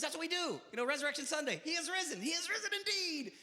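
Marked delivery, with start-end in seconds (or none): 1.6-3.3 high, sing-song congregation reply; church call and response. Retold or imitated. Congregation answering the Easter greeting, high and chanting. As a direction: intone it like a proud churchgoer.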